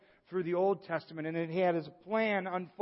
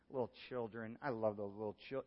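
lightly voiced sweet older lady, soft and cooing; a hint of a sweet old lady, softer; 5 semitones lower, 8 dB quieter than usual. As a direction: coo it like a kindly grandmother. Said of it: "I think of Mary Zaletti".

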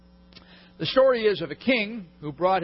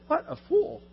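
high, scornful disbelief; preacher scoffing at the fool; exasperated scoff; 13 semitones higher than usual. Retold or imitated. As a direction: scoff at the foolish servant. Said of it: "I said".

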